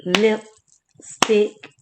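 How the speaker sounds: "Lipstick" split into two beats, lip... stick; clapped syllables. Shown as a demonstration. Teacher claps the word with pupils, splitting "Lipstick" in two. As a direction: say "Lipstick" one syllable per clap. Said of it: "Let's clap it".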